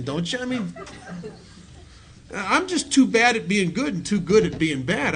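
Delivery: ordinary delivery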